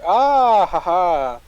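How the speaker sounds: loud, high burst of laughter. Real, not acted. Sudden laugh at discovered feature.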